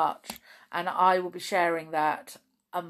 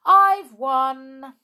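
higher, loud winner's cry; gleeful triumph; 7 semitones higher, 12 dB louder than usual. Acted. Binky winning the card game, gleeful. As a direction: crow it out in glee. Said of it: "took the last pile of cards in glee".

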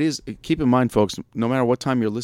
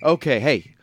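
1 radio host intro; 2 semitones higher, 5 dB louder than usual. Acted. Radio host opening the show.

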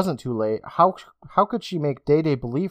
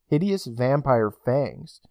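plain reading voice

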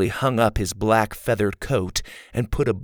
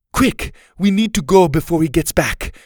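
high, loud and hurried; urgent; 8 semitones higher, 6 dB louder than usual. A narrator's reading. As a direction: hurry them out, urgent. Urgent escape.

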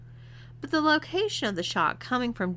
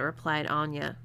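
plain reading voice

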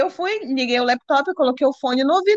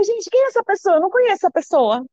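higher-pitched, bewildered questioning; retold disbelief; 8 semitones higher than usual. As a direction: retell being aghast, pitch jumping up. Retold disbelief at boyfriend's behaviour. (incredulidade recontada, em tom mais agudo)

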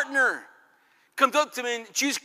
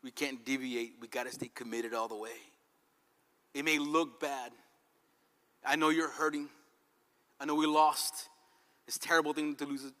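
tender consoling husband; 8 semitones lower, 7 dB quieter than usual. Retold or imitated. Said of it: "Job says".

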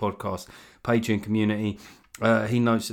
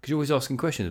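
ordinary talk, nothing marked

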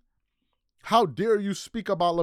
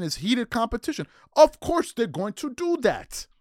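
normal talking voice

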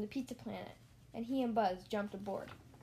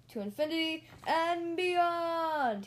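slow, higher, louder heroic shout; heroic cry; 6 semitones higher, 6 dB louder, slower than usual. Acted. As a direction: belt it out like a superhero. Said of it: "he cried".

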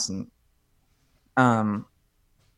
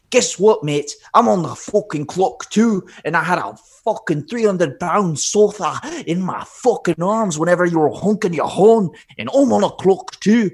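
retold Irish mover yelling back, higher and louder; angry shout; 7 semitones higher, 6 dB louder than usual. Irish mover yells at the honking driver: angry shout. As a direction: bellow it at the impatient driver, furious.

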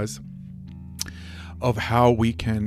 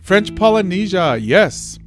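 high, loud, delighted shout; excited; 8 semitones higher, 8 dB louder than usual. Real, not acted. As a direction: cheer the name out happily. Delighted to spot a country.

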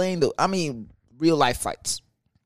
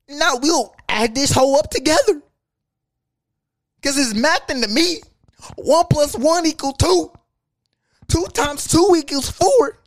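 high, loud sing-song taunt at the GM; mocking sing-song rant; 12 semitones higher, 6 dB louder than usual. Mocking the GM about the math in a high, loud sing-song. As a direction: belt it out like a mocking chant. Ranting at the GM.

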